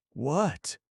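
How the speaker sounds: sharp high astonished what; startled old man crying out; amazed cry. A narrator's reading. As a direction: jump at her words in amazement.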